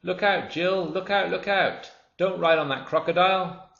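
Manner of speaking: high, loud bird cry; urgent singsong warning. Acted. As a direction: bird warning Jill from the sky.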